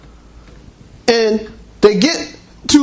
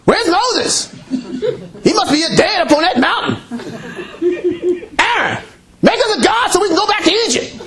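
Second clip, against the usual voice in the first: higher, fast grumbling crowd voice; upset Israelites; 6 semitones higher than usual. Retold or imitated. Preacher voicing restless Israelites demanding a god, higher grumble. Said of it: "People upset".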